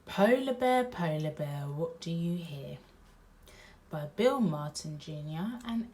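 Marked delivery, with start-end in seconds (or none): 0.1-2.8 lively read-aloud voice; storybook read-aloud; reading to children. A narrator's reading. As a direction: read it to little listeners. Reading picture book aloud.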